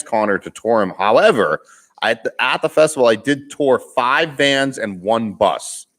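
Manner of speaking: ordinary talk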